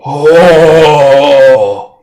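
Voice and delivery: high, loud astonished cry; stunned giant exclamation; awed gasp. Acted. Cyclops in awe.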